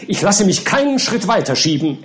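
firm, high-pitched refusal; resolute. A narrator's reading. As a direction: put your foot down indignantly. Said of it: "erklärte der Gelehrte entschlossen".

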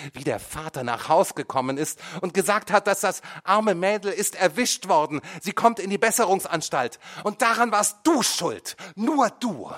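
high, loud, rising accusation; accusing. A narrator's reading. Son blames his mother, high and loud. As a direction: drive the blame home.

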